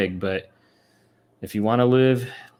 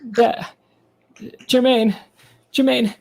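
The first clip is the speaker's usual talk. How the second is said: [name]; 10 semitones higher than usual. Acted. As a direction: call out in a high put-on voice. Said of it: "He goes".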